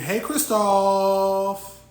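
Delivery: high, drawn-out shout; big, slow sing-out hello; calling out greeting. Real, not acted. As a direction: holler the name, stretching it out.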